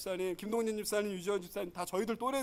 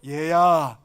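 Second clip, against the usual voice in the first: put-on loud call to a child; 4 semitones lower, 10 dB louder, much slower than usual. Shown as a demonstration. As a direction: call out to the son. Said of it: "같이 해보죠".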